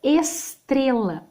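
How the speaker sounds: Estrela drawn out slowly, the S heard; slow demo word. Shown as a demonstration. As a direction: stretch Estrela for the class. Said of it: "a palavra estrela é um exemplo bom". (Estrela dita bem devagar)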